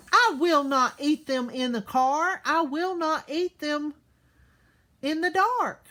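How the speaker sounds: loud, high, angry refusal; mad Sam refusal. Acted. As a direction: stomp your foot and refuse loudly. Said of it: "when he was mad".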